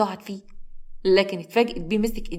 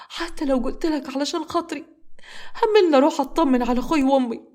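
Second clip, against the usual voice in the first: high, tearful begging; fearful crying plea; 6 semitones higher than usual. A narrator's reading. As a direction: plead through fear. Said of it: "وهي بتبكي بخوف".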